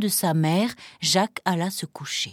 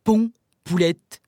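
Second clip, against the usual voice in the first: slow, commanding ogre voice; drawn-out command to a hen; ogre's command; 2 semitones lower, 4 dB louder than usual. Acted. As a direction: order it as a ponderous ogre. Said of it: "dès qu'il eut ordonné".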